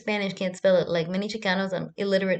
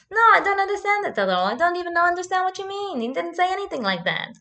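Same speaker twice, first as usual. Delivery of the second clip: high, indignant mock German voice; Basti, German accent; 9 semitones higher, 4 dB louder than usual. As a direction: deny it in a put-on German accent. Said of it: "that's my Basti impression".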